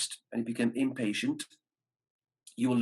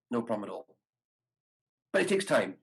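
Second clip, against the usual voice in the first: ordinary delivery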